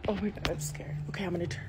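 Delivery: hushed and spooked; scared whisper. Real, not acted. Filming alone at night, whispering scared. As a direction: whisper it, frightened, so nothing hears.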